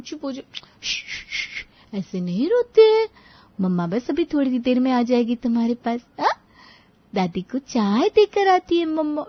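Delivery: put-on cooing baby talk with shushes; soothing a baby. Mother quiets her crying baby, cooing and shushing. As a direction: hush and coo to a crying baby. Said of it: "रोते हुए बिट्टू को चुप करने लगी".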